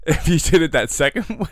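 ordinary talk, nothing marked

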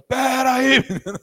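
put-on high, loud yell to stop a boy; shouted from the back. Deacon yelling at the boy near the curtain, loud and high. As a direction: bark at the kid from across the church. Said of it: "alguém gritou lá do fundo".

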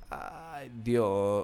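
breathed-out, voiceless start, very soft; hushed shock. Retold or imitated. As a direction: reenact being stunned, almost whispering. Reenacts shock at the discovery.

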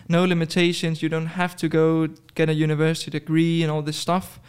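ordinary talk, nothing marked

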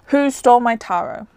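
usual speaking voice

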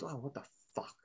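very soft muttered curse; muttered flub. Retold or imitated. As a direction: drop it under your breath. Quoting Betty flubbing a line.

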